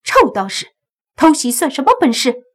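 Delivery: high, loud, contemptuous jab; scornful retort. A narrator's reading. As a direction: throw it back with cold scorn. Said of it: "洛言冷哼了一声说道".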